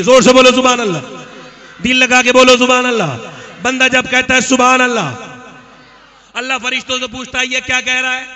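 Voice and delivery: booming, exhorting preacher; full-force preaching. Real, not acted. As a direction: ring it out to the whole crowd.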